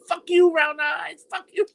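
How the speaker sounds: hammed-up phone insult; imitated angry caller. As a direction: hurl it as a taunting caller. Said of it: "They might be on that phone going".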